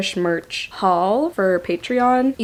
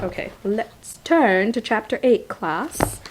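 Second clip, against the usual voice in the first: put-on slow teacher-to-class line in an odd accent; 2 semitones higher, slower than usual. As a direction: play at addressing a class in a made-up accent. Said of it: "I don't know what that accent was".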